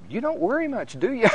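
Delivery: mother's teasing question, lifted high, laughing out; a fond, teasing mom ribbing her son. Retold or imitated. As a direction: her son gets a playful jab from mom. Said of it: "She says".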